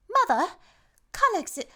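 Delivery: high, loud mock-British child; British accent. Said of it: "does this child have a British accent".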